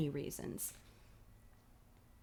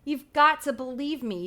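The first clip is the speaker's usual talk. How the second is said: pleading; 5 semitones higher, 6 dB louder than usual. Acted. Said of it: "you plead".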